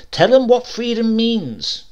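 King pushes the committee, loud and slow; eager ad man. A narrator's reading. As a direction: drive it home like a salesman. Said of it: "King said".

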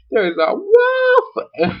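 high, drawn-out comic wail; hammed-up high squeal; mock alarm. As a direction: cry out the warning in fake panic. Acted Lucy announces a wolf.

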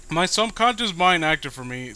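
ordinary talk

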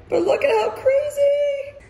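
high, loud emotional cry; overwhelmed cry. Real, not acted. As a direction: cry out in disbelief. Seeing the emptied house.

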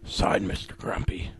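low breathy signing-off whisper; villain whispering his name; sinister whisper. Acted. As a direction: sign the name like a threat. Sinister signature of the letter.

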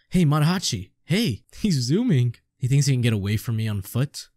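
ordinary talk

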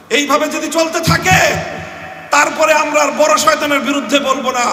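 fervent shouting preacher; fiery preaching. Real, not acted. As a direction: thunder it out to the crowd, building. Preaching at full force.

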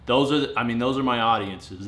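ordinary delivery

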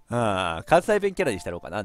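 usual speaking voice

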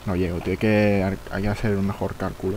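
ordinary talk, nothing marked

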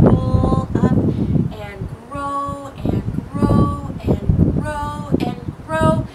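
slow, stretched grow and grow; slowly. Shown as a demonstration. Leading kids' stretch as a seed, slow stretched grow. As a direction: draw each grow out slowly. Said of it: "And then very slowly".

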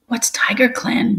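a shy little apprentice asks; small voice. Acted. Apprentice asks the elders, in a small high voice. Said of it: "mewed a small voice".

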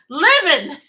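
bursts into real loud laughter; laughing. Real, not acted. Laughing at a viewer's comment.

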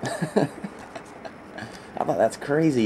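slow words through a real laugh. Real, not acted. Seller apologizes for gushing over the pull, laughing. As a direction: laugh it out, can't get over it.

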